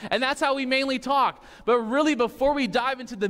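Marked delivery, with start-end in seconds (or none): none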